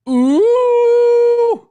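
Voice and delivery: shrill, very high, loud mocking ooh. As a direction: stare him down and coo it high and loud. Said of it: "look him right in the eyes and go".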